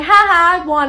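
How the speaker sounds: loud, high mock gloating; gloating winner. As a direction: crow over a cheap win. Crowing winner in acted quarrel.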